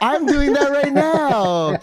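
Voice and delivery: high, slow put-on voice; high, slow jokey self-quote; mock confession. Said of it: "I'm like".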